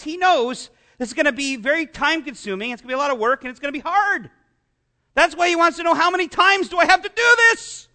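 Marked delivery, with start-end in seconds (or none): 5.9-7.9 exasperated question. Retold or imitated. Peter voiced asking Jesus.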